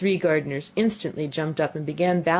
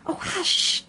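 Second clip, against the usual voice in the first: urgent, breathy hush; high, voiceless frightened warning; frightened whisper; 13 semitones higher than usual. A narrator's reading. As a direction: shush her in alarm. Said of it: "whispered in a frightened tone".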